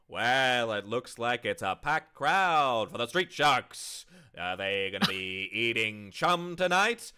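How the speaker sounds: booming announcer voice; loud, hyped-up play-by-play; sports commentator. Acted.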